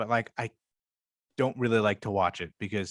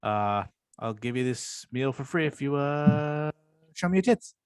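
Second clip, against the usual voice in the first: Sal's hesitant delivery; 3 semitones higher than usual. Retold or imitated. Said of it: "he said it like that".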